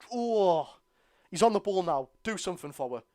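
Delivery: usual speaking voice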